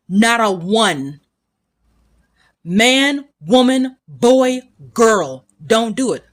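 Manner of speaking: usual speaking voice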